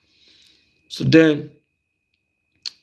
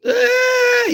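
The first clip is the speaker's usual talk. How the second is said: hammed-up cry; mock crying wail. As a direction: yelp like a bawling kid. Imitating the kid crying.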